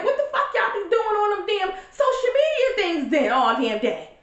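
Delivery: put-on high, outraged mom scolding her kids; mother mock-ranting at kids who can't use social media. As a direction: yell at the lazy kids, pitch way up. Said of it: "So I'm like".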